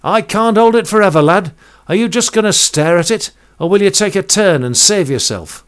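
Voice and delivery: loud, high stern Welsh sailor. Retold or imitated. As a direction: bark it like a stern old Welsh pilot. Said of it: "I heard a stern Welsh voice".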